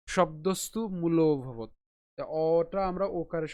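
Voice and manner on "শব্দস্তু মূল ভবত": slow শব্দস্তু মূল ভবত in one flow; whole phrase. Shown as a demonstration. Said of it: "যদি পুরোটা উচ্চারণ করি". (পুরোটা ধীরে একটানে)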